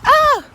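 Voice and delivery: sharp high yelp; high yelp from cold. Real, not acted.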